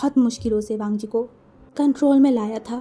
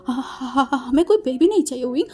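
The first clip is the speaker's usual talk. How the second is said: higher, stammering, begging; desperate pleading; 4 semitones higher than usual. Acted. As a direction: beg him never to leave you. (घबराकर हकलाते हुए मिन्नत)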